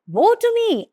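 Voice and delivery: high, anguished cry of woe. Acted. Isaiah cries out before the vision of God, anguished. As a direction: cry out in dismay at your own guilt. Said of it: "I cried".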